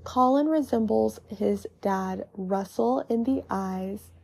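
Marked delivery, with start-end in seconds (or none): none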